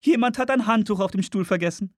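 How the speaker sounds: hoch gesetzte Frauenstimme; Frauenfigur. Acted. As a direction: spiel eine Frau in hoher Lage. Woman voiced in narration. (hohe Frauenstimme)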